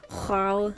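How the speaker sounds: "Joao" started with a raspy H; throaty H pronunciation. Shown as a demonstration. Player showing off the name, "Joao" with a throaty H. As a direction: say "Joao" with a throat-scraped H in place of J. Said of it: "You gotta get a H".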